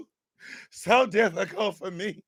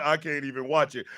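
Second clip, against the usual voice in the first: mocking the excuse; 8 semitones lower, 5 dB quieter than usual. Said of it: "talking about".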